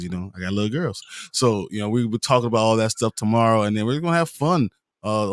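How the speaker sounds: normal talking voice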